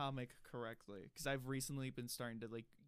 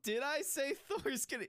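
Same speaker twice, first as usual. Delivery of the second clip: amused surprise; 12 semitones higher, 6 dB louder than usual. Real, not acted. Realizes he misspoke, laughing.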